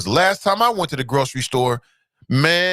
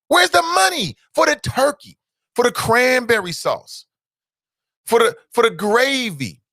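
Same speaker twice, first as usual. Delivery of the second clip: rising demand; 6 semitones higher than usual. Real, not acted. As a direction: chant each item like a protest. Chant listing holiday foods.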